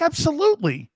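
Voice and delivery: mock sarcastic wife. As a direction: say it like you mean the opposite.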